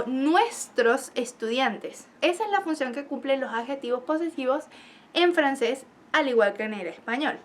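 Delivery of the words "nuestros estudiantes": each syllable stretched out slowly; slow example phrase. Shown as a demonstration. Teacher spelling out an example for her class, very slow. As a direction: lay the example out slowly for students.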